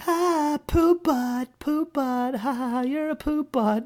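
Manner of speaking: mock a toddler teasing the bull; giggling toddler voice. Dad imitating daughter taunting the bull, childlike. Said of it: "laughing at this huge Brahmin bull and pointing and going".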